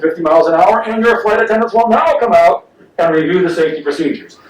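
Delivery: a lightly voiced airline captain, calm and routine; piloty voice. Pilot announcing mid-flight trouble over the ocean, calm piloty voice. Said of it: "with the piloty voice that they all have".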